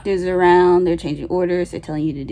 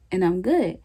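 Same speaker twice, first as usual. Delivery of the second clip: ordinary delivery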